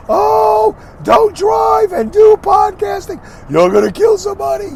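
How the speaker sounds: absurd high, drawn-out falsetto shriek; hammed-up hysterical worrier in a very high voice; panicky, overreactive voice. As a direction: wail it out slowly, as an absurd mock panic. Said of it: "Why do I always choose that voice".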